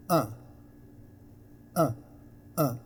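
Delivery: short, isolated "uh" vowel; schwa sound. Shown as a demonstration. Teacher showing learners the schwa sound, short "uh". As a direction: say "uh" as a brief neutral schwa. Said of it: "essentially it is".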